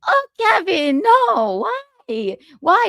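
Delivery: high-pitched wail fading to a small why; mock dismayed wife. Imagined wife reacting to her husband's confession, mock dismay. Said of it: "Do you think she goes".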